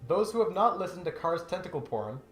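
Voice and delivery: ordinary talk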